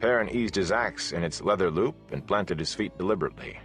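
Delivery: usual speaking voice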